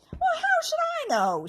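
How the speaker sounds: very high, loud snappish goblin; snapped. A narrator's reading. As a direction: snap back irritably. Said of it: "snapped the other goblin".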